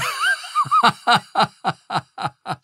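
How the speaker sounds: put-on high, wheezy old man's laugh; old man's cackle. A narrator's reading. Old cop laughing at his own joke, a high cackle.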